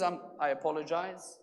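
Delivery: subdued voice of someone excusing himself; meek apology. Retold or imitated. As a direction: back down quietly and politely. Stranger apologizes after being scolded.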